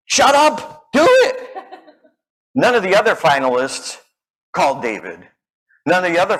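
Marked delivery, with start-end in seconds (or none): 0.1-1.5 high, loud self-urging with a laugh; inner voice. Retold or imitated. Mark's inner voice pushing him to call, high and loud. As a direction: bark at yourself to just go. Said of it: "He's a, uh".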